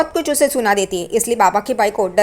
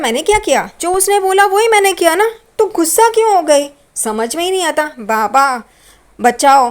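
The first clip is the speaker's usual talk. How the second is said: higher, louder mock complaint; comic helpless grumble; whiny bewilderment; 6 semitones higher, 4 dB louder than usual. Tejas whines after wife storms off. (बीवी के जाने पर झुँझलाकर शिकायत करता हुआ)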